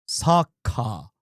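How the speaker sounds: low, put-on Japanese accent; "soccer" in a deep imitated Japanese accent; mock Japanese pronunciation, pitched low. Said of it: "Japan calls it something different".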